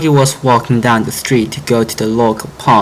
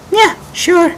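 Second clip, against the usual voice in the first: much higher character voice; friends agreeing. Acted. Friends agreeing to help frog, high light voice. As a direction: agree with a shrug.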